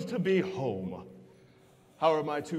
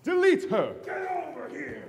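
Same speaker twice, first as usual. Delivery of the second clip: loud villain command; commanding shout; 2 semitones higher, 6 dB louder than usual. Acted. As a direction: bark the order at her. Villain orders execution.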